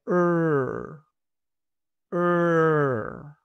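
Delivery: "er" slow and r-colored; American r vowel. Shown as a demonstration. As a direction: curl the tongue on "er". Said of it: "influye mucho en el sonido". ("er" con R americana)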